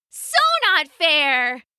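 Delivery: high, drawn-out protest; mock-outraged squeal at a playmate, stretched out; playful shriek. A narrator's reading. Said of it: "Isabella shrieked, laughing".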